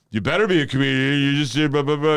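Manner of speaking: loud, high imitation of a pushy booker; high, forceful retold rant; rattling off. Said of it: "he, like, rattled off my shit".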